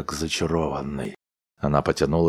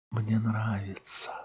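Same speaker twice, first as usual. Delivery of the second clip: sleepy whisper; 5 semitones higher, 9 dB quieter than usual. A narrator's reading. Wife talks in her sleep.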